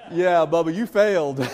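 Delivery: big-brother ribbing that breaks into laughter; laughing taunt. Retold or imitated. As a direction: rub it in while cracking up. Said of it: "I said".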